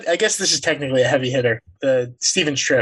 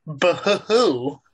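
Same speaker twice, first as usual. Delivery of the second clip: slow, drawn-out mock boo-hoo; mock crying; much slower than usual. Teasing a co-host for his failed accent with slow fake sobs. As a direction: fake sobbing to tease him. Mocking co-host's blown accent.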